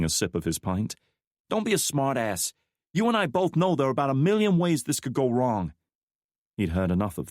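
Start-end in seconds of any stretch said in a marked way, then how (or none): 1.5-5.7 higher woman's voice, exasperated; a worried woman pushing back; McKnight's voice. A narrator's reading. McKnight confronting Drake over his plan, higher.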